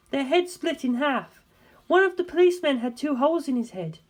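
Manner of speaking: a hint of a grave woman asking; earnest, probing question, lightly voiced; a faint woman's voice, asking seriously; lightly voiced Darlington. A narrator's reading.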